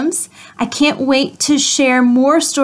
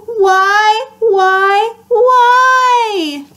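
rising hammed-up whine, higher and louder; mock wail; 6 semitones higher, 4 dB louder, much slower than usual. Pigeon wailing at the child about the cookie. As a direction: wail it like a sulky bird.

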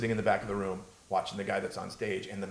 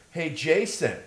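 louder, higher hail; calling out; 7 semitones higher, 6 dB louder, slower than usual. Retold or imitated. Brian Regan spots comedian climbing the stairs, calling out. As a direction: call down to someone below.

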